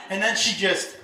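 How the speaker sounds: usual speaking voice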